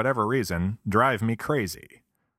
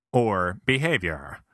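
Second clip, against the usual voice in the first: ordinary talk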